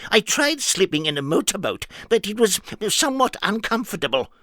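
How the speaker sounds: high, earnest nonsense character; high silly voice. Acted. Mister Nonsense explaining himself, high and earnest. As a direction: say the daft logic perfectly seriously, up high.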